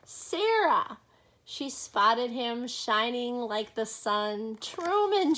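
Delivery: ordinary delivery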